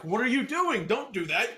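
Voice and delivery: loud, high-pitched scolding at the screen; mock movie heckle. Moviegoer talking back to the film's characters, loud mock scolding. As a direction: exclaim at a character's bad decision. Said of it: "I talk through those movies".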